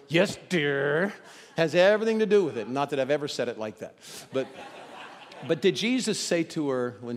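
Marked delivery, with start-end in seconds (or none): 0.1-1.1 grudging yes dear. Shown as a demonstration. Husband answering his wife, grudging and put-upon. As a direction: grumble it reluctantly. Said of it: "how you say".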